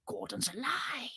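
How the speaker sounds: whispered catchphrase. Pretend. Presenter murmuring a viewer's catchphrase, soft whisper. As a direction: whisper it like a secret.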